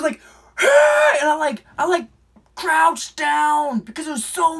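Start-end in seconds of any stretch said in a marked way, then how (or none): 0.6-1.2 huge high growling shout; Kraken yell. Retold or imitated. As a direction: roar it like a monster. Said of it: "it was so loud".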